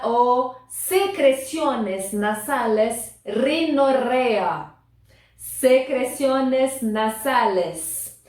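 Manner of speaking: very slow, spelled-out teacher reading; slow model words. Shown as a demonstration. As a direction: draw each syllable out for the class. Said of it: "teacher modelling vocabulary". (professora lendo bem devagar)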